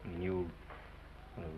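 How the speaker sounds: tender farewell. Acted. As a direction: say goodbye tenderly.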